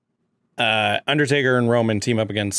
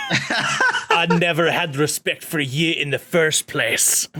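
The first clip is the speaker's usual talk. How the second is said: slow, laughing Scottish accent; 3 semitones higher, slower than usual. Shown as a demonstration. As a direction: try a Scottish accent and crack up. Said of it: "in a Scottish accent".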